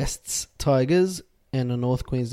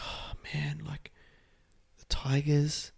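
exasperated breathy whisper; 2 semitones higher, 10 dB quieter, slower than usual. Real, not acted. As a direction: sigh it out under your breath. Despairing over the tip.

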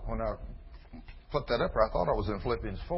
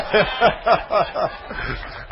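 wheezing breathless laugh; wheezing laughter; silent laugh; 8 semitones higher, 6 dB louder than usual. Real, not acted. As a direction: laugh without voice.

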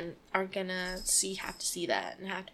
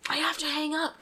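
a hint of a snotty dispatcher, higher; mocking the dispatcher; 5 semitones higher, 3 dB louder than usual. Mocking the rude 911 dispatcher, lightly voiced. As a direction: sneer the line back in a put-on dispatcher voice. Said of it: "You don't have to be an ass".

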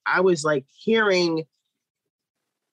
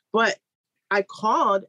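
ordinary talk

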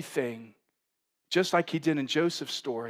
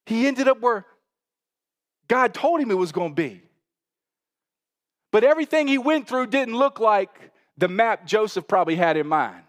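loud, higher, driving sermon voice; full-force preaching; 7 semitones higher, 7 dB louder than usual. Acted. Pastor preaching to the congregation, loud and high. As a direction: preach it out to the whole church.

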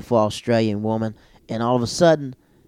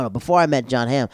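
ordinary talk, nothing marked

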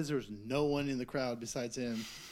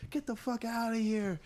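shop staff yelling; 7 semitones higher, 3 dB quieter than usual. Retold or imitated. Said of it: "They're like".

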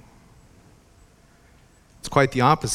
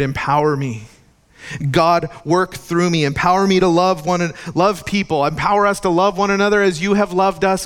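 higher, louder impassioned sermon; fervent preaching; 5 semitones higher, 3 dB louder than usual. Acted. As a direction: preach it with rising fervor.

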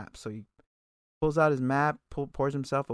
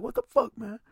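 mock outraged cry, pitched up high; high-pitched mock indignation; indignant protest; 10 semitones higher than usual. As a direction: imitate an annoyed crowd protesting. Retold crowd's protest at queue jumper.

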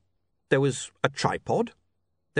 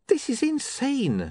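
disbelief; 13 semitones higher, slower than usual. Acted. William overwhelmed by money.

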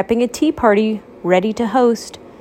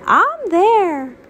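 eager Toast; 10 semitones higher than usual. Acted. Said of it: "Toast said".

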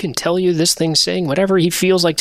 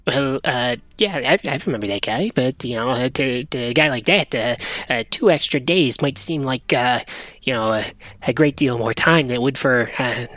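lower, soft, halting mumbler; furtive little creature; 4 semitones lower, 3 dB quieter than usual. Acted. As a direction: ramble unsurely, padded with uhs.

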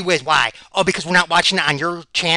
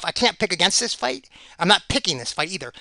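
usual speaking voice

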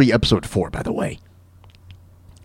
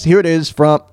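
usual speaking voice

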